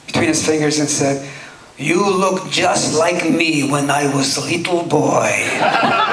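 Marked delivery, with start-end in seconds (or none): 1.8-6.1 put-on slow, thick Russian accent, fond; Akim Tamirov's Russian accent. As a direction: drag each word out in a heavy Russian accent. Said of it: "He had a very thick Russian accent".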